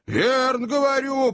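high-pitched, heated cabman; heated confirmation. Acted. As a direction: insist on it to the whole crowd. (запальчиво, высоким голосом)

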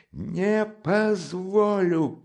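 slow, high, loud shout; shouting again. Acted. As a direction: hammer the refusal. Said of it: "крикнул было другой раз".

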